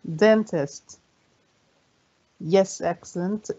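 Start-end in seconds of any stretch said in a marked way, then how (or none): 0.1-1.0 "Dentist" as DEN-tist, falling at the end; stress on first syllable. Shown as a demonstration. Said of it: "هنا في أول مقطع صوتي بيكون قوي".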